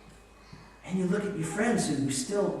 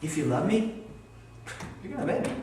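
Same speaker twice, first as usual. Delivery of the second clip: gentle reading; 3 semitones higher, faster than usual. Acted. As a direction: offer it as a loving question. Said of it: "listen, I read it this way".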